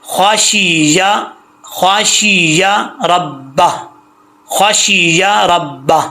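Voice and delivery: slowly, with the یا in خواہ شی یا ربا fully sounded; clear ya sound. Shown as a demonstration. Teacher modelling the correct reading for students, slow and clear. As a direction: draw out یا so it never drops. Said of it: "یا کا sound آنا چاہیے".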